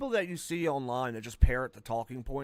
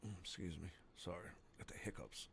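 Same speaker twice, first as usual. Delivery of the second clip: quiet aside; 8 semitones lower, 19 dB quieter than usual. Real, not acted. Apologizing quietly while gaming.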